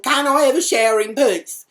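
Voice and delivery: loud, high-pitched complaint; indignant protest. Retold or imitated. Housemate spied on in the shower, loud indignant protest. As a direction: protest loudly as the wronged party.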